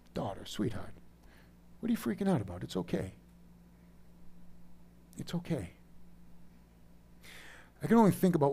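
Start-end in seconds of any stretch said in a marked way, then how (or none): 0.1-0.9 soft, low endearment; soothing father. Retold or imitated. Father to his daughter who is freaking out, soothing. 1.8-5.7 soft, lightly raised reassurance; soothing father. Retold or imitated. Father to his daughter who is freaking out, soothing.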